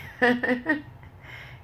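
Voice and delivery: a sudden high laugh; bright laugh breaking out; high burst of laughter. Real, not acted. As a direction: laugh out at the memory. Laughs at own retort.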